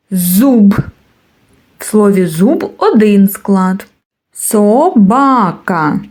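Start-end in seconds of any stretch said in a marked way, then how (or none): 4.3-6.0 slow, split into syllables: Со-ба-ка; teacher sounding out "Собака" slowly. Shown as a demonstration. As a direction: stretch "Собака" so each syllable is clear. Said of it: "Скільки складів у слові собака?".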